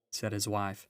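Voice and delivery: ordinary delivery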